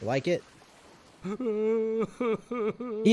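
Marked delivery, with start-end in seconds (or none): 1.2-3.1 slow, high, mopey voice; sulky parrot. Pretend. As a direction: drag it out like a sad pet bird. Voicing the parrot's sulky reaction.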